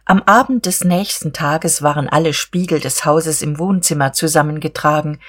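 plain reading voice

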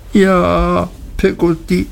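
put-on understated tearful child, high-pitched; sobbing. A narrator's reading. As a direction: answer high and tearful, like an upset boy. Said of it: "schluchzte ich".

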